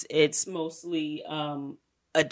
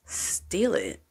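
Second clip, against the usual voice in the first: sly whisper; 5 dB quieter than usual. Retold or imitated. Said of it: "twisted up face, like".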